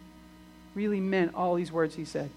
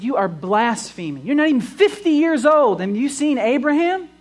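higher and louder, incredulous outrage; outraged accusation; 6 semitones higher, 6 dB louder than usual. Acted. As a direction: hurl the charge at him in disbelief. Hearers accuse Jesus of blasphemy.

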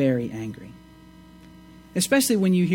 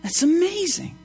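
higher, louder, incredulous questioning; amazed wonder; 6 semitones higher, 3 dB louder than usual. Real, not acted. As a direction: marvel at it, peaking very high. Marvels at Jesus' promise.

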